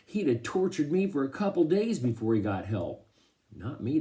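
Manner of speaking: ordinary delivery, nothing marked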